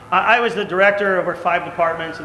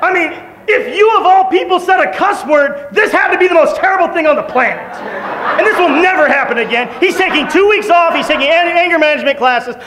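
loud, higher, laughing retelling of the boss; emphatic boss; 10 semitones higher, 5 dB louder than usual. Retold or imitated. President assures the preacher the founder is punished, laughing. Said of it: "and he's like".

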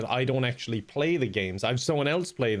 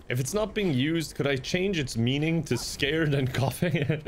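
normal talking voice

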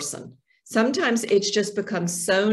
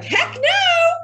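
high squeaky frog voice, much higher; a cartoon frog squeaking no way; frog's refusal; 6 dB louder than usual. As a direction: pipe up like a startled frog. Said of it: "that frog's like".

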